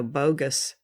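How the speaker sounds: normal talking voice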